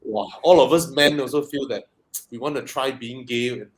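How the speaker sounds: normal talking voice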